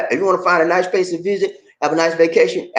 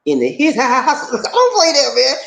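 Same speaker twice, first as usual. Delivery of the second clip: high, laughing through the words; 11 semitones higher, slower than usual. Real, not acted. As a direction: break up laughing while talking. Laughing at being out in heat.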